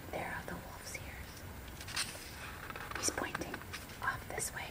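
hushed aside about the picture; whisper. Real, not acted. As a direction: whisper it softly. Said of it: "whispered picture-book asides".

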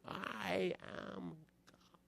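high but very soft mock yell; hushed, held-back imitation of yelling; half-hearted retold yell. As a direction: mimic a yell under your breath. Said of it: "she's yelling that out".

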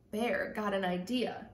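usual speaking voice

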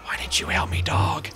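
hushed whisper to the dog. Real, not acted. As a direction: whisper the reproach.

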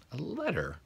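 ordinary delivery, nothing marked

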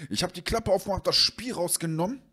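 high, sheepish voice of the buddy; nervous friend. Retold or imitated. Friend telling the owner about the broken game, high and flustered.

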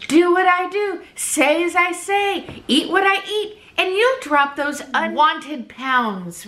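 hammed-up preachy guru, stretching the words; mock weight-loss guru. Mocking a know-it-all Weight Watcher addressing YouTube folks, mock guru pitch. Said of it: "I'm gonna tell you fine folks".

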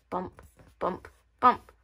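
ordinary delivery

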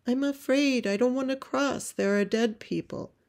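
put-on small boy calling back in fear; scared little boy. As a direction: plead like a frightened child. Frightened boy calls back to mother.

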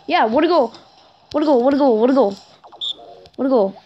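excited repeated cheering; freaking out. Real, not acted. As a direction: cheer your own goal over and over. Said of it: "I'm freaking out here".

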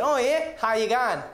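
put-on broad Australian accent, lifted at the start; Australian counter clerk. Airline clerk greeting a traveller, Australian accent. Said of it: "And she said".

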